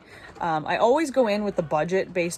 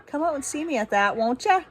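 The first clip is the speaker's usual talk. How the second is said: a light, playful Canadian lilt, higher; mock Canadian; 6 semitones higher than usual. Vlogger inviting viewers to the Beer Fest, playful mock Canadian. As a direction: tease the crowd with a hint of Canadian accent. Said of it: "I just turned Canadian".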